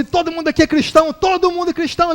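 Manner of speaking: high, loud mock preaching; fired-up pastor. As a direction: imitate a preacher rousing the congregation. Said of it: "E ele falava assim".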